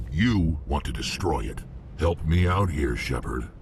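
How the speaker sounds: ordinary talk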